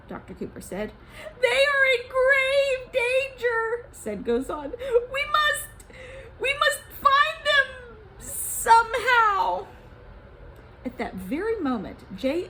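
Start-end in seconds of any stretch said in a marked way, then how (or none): 1.4-3.9 high, slow, louder warning; worried Gozan. Acted. Gozan warns. 5.1-9.7 high, slow, louder warning; worried Gozan. Acted. Gozan insists.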